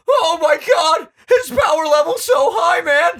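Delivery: high, loud, slow cartoon stoner shout; Shaggy voice. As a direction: yell it like Shaggy, stretched out. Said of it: "I went a little Shaggy there".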